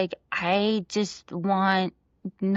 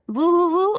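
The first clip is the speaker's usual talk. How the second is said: high, louder woo-woo siren call; siren imitation; 9 semitones higher, 6 dB louder than usual. Mother echoing her son's siren noise, high and loud. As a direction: play the siren sound back to a child.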